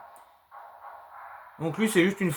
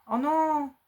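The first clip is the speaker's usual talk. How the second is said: disappointed; 10 semitones higher than usual. Real, not acted. Music stopped, mock dismay.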